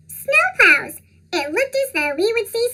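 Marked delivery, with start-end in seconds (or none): 0.1-1.0 excited shout. Acted. Kids spotting plows.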